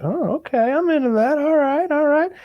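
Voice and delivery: high breezy agreeable voice; she's like. Retold or imitated. Mocking the heroine, high eager agreement. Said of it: "she's like".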